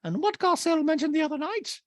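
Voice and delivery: very high caricature voice; Dorit impression. Dorit in a talking head, squeaky high voice. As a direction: squeak it out, put-on. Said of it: "Dorito the Talking Head goes".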